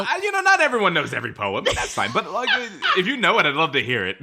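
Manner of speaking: high laughing tease. Real, not acted. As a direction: giggle through the words. Laughing teasing about poem.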